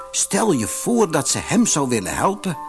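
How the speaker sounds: plain reading voice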